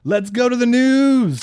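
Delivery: mock announcer. Cueing the news segment to the co-host, hammed-up announcer.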